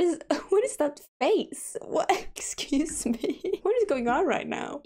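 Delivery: laughing disbelief. Real, not acted. Sim makes a strange face.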